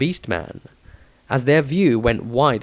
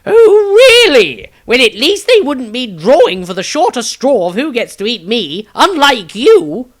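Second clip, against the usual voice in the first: much higher, louder huffy comeback; indignant retort; 12 semitones higher, 11 dB louder than usual. A narrator's reading. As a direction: snap back with offended sarcasm. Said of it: "Maestro glared".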